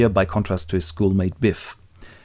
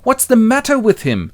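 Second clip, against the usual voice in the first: high, loud complaint; acted exasperation; 13 semitones higher, 5 dB louder than usual. Biff, woken up, asks about his father, exasperated. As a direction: demand it in a raised voice. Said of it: "and Biff goes".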